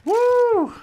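high, loud victory whoop; excited whoop. Real, not acted. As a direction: let out a triumphant woo. Celebrating a kill in game.

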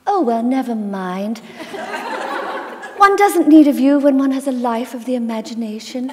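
high, sweet, condescending with a giggle; lightly laughing sugary dismissal; high posh lady voice with a little laugh; girlish posh grandmother, laughing. Retold or imitated. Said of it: "She'd go".

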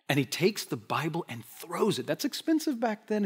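ordinary talk, nothing marked

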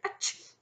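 sudden acted sneeze; dragon's sneeze. A narrator's reading. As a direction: sneeze mid-thought. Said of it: "accompanied the dragon's sneeze".

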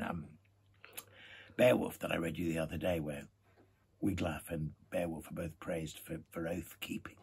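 plain reading voice

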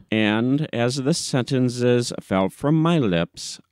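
plain reading voice

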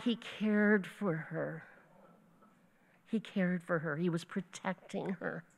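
quiet, sincere reflection after the joke; hushed tenderness. Real, not acted. Preacher on a brother protecting his sister, hushed and tender. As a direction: drop to a soft, tender voice.

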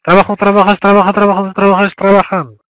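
loud, high chanted drill; teacher's loud conjugation chant. Shown as a demonstration. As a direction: call out each form loudly for the class.

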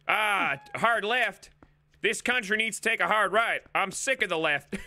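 hammed-up country accent; put-on country accent. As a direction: complain like a country-accented old-timer. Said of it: "in this accent".

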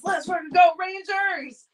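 loud hockey cheer; fan's rallying cry read out loud. Pretend. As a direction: shout it for the team. Reading a fan's cheer aloud.

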